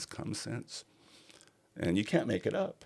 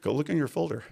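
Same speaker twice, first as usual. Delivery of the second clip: inner voice; 10 semitones higher, 4 dB louder than usual. Retold or imitated. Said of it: "a voice came and said".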